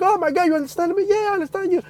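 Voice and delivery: high, fast, thrilled; bursting with disbelief; excited amazement. Retold or imitated. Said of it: "he's like".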